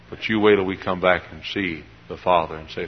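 ordinary talk

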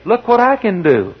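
put-on showing-off kid. Kid showing off a trick to a grown-up, high boastful voice. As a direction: call it out like a child showing off. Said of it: "When I was a kid".

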